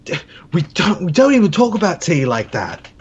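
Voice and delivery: a Brit lightly scoffing at the Americans; mock British reply. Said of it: "He's probably like".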